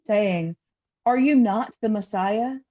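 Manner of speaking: ordinary talk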